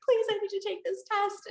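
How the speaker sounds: high, frantic begging; desperate plea. Retold or imitated. Emailing Donna at midnight, high desperate pleading. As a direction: beg like a crazy person in the night. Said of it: "and being like".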